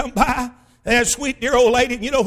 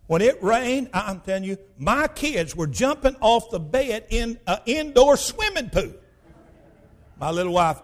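ordinary talk, nothing marked

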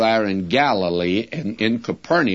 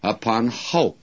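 ordinary delivery

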